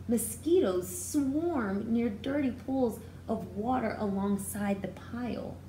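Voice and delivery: plain reading voice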